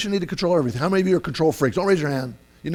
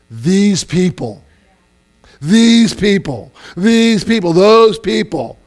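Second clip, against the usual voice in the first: loud, high, drawn-out exasperation; acted disdain for the others; us-and-them contempt; 6 semitones higher, 7 dB louder, slower than usual. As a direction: spit out each phrase slowly, as an accusation. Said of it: "if you ever start talking like that".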